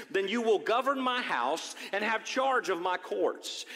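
solemn scripture read from the pulpit. Acted. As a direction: proclaim the Lord's words to the church. Reads scripture aloud to congregation.

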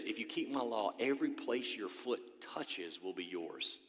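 near-whispered, slow retelling; hushed repeat. Acted. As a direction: let the promise sink in softly. Same promise repeated softly.

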